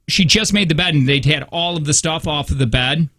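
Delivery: ordinary delivery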